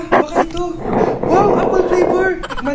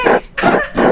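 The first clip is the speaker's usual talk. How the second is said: breathy sly giggle; 8 semitones higher than usual. Acted. Sly laugh selling fake product.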